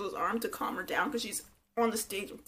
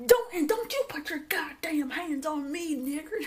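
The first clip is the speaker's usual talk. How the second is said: mock yelling; 7 semitones higher than usual. Angela warns Tyreek off her arm, mock yelling. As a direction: imitate her furious warning, pitched up. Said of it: "start yelling".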